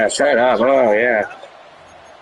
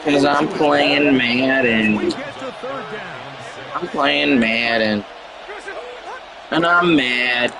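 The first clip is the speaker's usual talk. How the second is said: mock complaining; 4 dB quieter, much slower than usual. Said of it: "I'm gonna complain and say".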